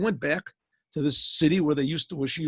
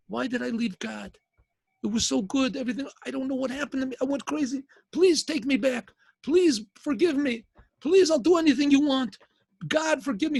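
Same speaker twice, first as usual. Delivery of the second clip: pleading, building to wailing; 7 semitones higher than usual. Acted. Husband begs his wife to take him back, building to a wail. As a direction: beg for forgiveness until it turns into wailing. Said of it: "Starts crying and wailing".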